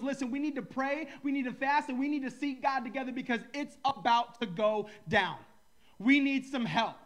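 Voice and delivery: loud urgent call to gather and pray; rally the people, loud and urgent. Retold or imitated. King calls his people to pray, loud and urgent.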